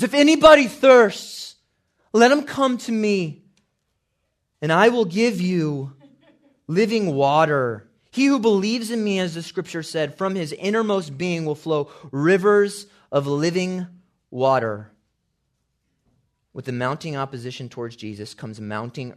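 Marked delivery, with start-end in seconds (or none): 0.0-3.5 full-force shout, very high and loud; Jesus. Acted. Crying out at the climax of the feast, shouting. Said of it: "Cried out. If you don't have a shouting Jesus". 4.6-14.9 loud, high proclamation at full force; Jesus. Acted. Promising living water to the feast crowd, shouting. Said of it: "Cried out. If you don't have a shouting Jesus".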